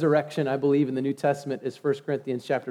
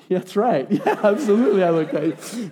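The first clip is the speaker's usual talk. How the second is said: laughing; 3 semitones higher than usual. Retold or imitated. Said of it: "I'm like".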